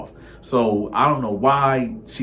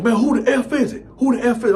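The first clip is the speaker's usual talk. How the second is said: high, loud shout through the door; angry yell; 13 semitones higher, 6 dB louder, faster than usual. Retold or imitated. Boyfriend yells at whoever is knocking, high and loud. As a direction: bark it at the door.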